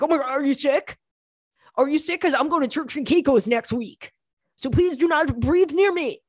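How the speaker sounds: high, hammed-up alarm; mock panicked germaphobe. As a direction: play someone freaking out about catching germs. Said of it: "They still have that thing".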